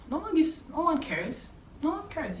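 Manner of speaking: high emphatic exclaim. Real, not acted. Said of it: "emphatic high".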